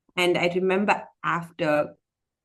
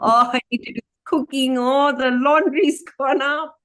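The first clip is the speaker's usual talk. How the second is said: hammed-up fussing over chores; mock whining; 7 semitones higher, 5 dB louder than usual. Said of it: "I'll go".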